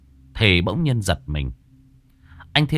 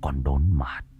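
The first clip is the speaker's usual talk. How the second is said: seethe the accusation close to her face; menacing low threat; 5 semitones lower than usual. A narrator's reading. Thiên grips Lài's hair and accuses her of the killing, lower and seething.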